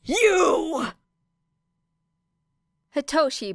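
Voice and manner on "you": hammed-up angry shout; higher, louder furious yell; fierce roar. A narrator's reading. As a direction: bark it at them furiously. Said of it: "followed by a fierce roar".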